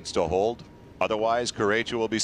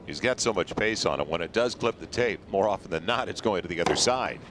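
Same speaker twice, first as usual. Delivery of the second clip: ordinary talk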